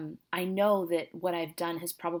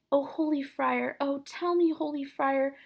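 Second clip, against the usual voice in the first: high, slightly slowed acted plea; pleading nurse; 7 semitones higher than usual. Nurse seeking Romeo from the friar, high and pleading. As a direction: beg the friar in a fretful high voice.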